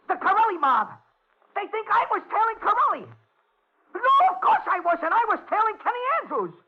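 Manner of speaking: high, frantic explaining; panicked. Acted. Hunted man phoning police about the mob, frantic high voice. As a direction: babble desperately for help.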